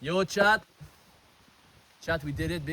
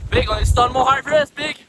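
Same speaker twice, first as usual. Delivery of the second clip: loud, higher excited call; hyped shout; 9 semitones higher, 13 dB louder than usual. Pretend. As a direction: announce the summit with hype. Hyped mock expedition call.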